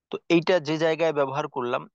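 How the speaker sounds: ordinary talk, nothing marked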